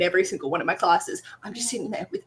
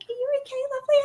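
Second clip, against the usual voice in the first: concerned stranger; much faster than usual. Retold or imitated. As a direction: ask gently like a worried stranger. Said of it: "the couple sitting next to me were like".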